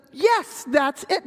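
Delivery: high, emphatic burst on yes; sudden eager agreement, acted; delighted monkey. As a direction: play a monkey seizing the idea. Monkey seizes on owl's idea.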